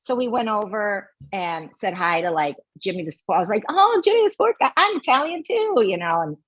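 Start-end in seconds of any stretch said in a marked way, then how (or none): 3.7-5.8 gushing greeting. Retold or imitated. Her younger self greeting the radio guy.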